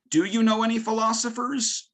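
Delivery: high-pitched question to a class; teacher quizzing students. Retold or imitated. Teacher fishing for anything students know, high questioning voice. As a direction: pitch it up, as if polling weak learners.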